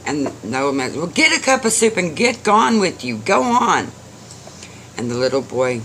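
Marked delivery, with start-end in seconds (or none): 0.9-3.9 high, irritated shooing; grudging dismissal. A narrator's reading. Old man sends boy off with soup, irritated. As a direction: give in crossly and shoo him off.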